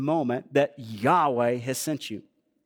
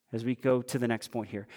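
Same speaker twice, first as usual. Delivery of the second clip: ordinary talk, nothing marked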